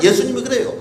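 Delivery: ordinary talk, nothing marked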